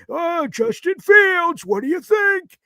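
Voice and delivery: high, slow old man imitation; hammed-up old man asking. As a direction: quiz him like the eager old fan. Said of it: "And it'll be like".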